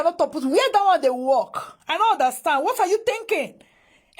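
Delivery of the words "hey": exasperated yell. Real, not acted. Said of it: "I feel like screaming".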